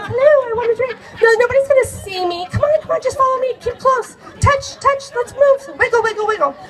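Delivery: high urgent coaxing; high excited. Retold or imitated. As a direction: chirp it out like wriggling through a packed bar. Squeezing through the crowd.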